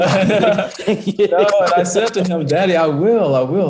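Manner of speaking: choking up while laughing, real emotion; emotional. Real, not acted. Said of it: "You making me cry".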